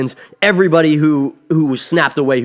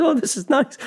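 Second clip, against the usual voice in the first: much higher, light imitation of Peter; touched mock voice; 12 semitones higher, 3 dB quieter than usual. Peter Parker getting a hug from Iron Man, touched high voice. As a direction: put on a high little voice.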